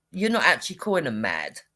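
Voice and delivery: ordinary delivery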